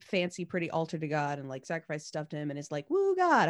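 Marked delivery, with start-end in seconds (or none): 2.9-3.5 mock cheer. Abram cheering God in retelling.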